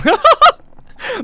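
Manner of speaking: high loud cackle; loud burst of laughter. Real, not acted. Streamer laughing at his own expert claim, loud cackle. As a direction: explode into real laughter.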